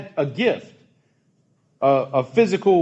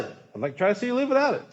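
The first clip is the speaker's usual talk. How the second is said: higher and fast, lyric rushed out as it dawns; struck repeat; 7 semitones higher, 5 dB quieter, much faster than usual. Retold or imitated. Pastor repeats the song line, suddenly struck by it, quick and higher. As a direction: repeat it quickly as the meaning hits you.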